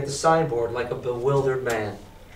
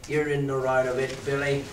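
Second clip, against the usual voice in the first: lightly voiced pirate. A narrator's reading.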